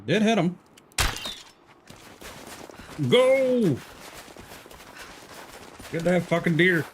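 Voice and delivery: normal talking voice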